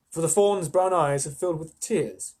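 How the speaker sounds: plain reading voice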